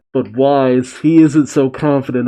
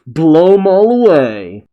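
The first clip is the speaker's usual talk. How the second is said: higher, louder cheer for the attack; rousing battle cry; 4 semitones higher, 6 dB louder than usual. A narrator's reading. Crewmate cheering on the cannon fire, rousing cry. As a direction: urge them on to destroy the enemy.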